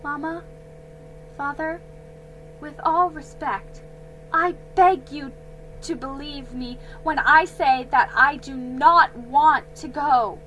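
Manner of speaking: shaky voice. A narrator's reading. Daughter begging her parents, shaky plea. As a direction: beg with a trembling voice. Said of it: "said in a shaky voice".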